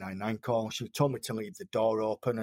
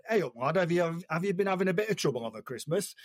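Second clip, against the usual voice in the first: higher-pitched, lightly imitated Yorkshire paramedic; mild Yorkshire impression, pitched up; Yorkshire accent; 6 semitones higher than usual. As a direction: put on a touch of broad Yorkshire. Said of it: "in his true Yorkshire accent".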